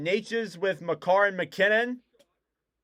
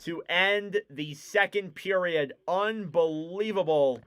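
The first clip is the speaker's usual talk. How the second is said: period wrap-up; 4 semitones higher, 4 dB louder than usual. Acted. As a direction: land the end of the period.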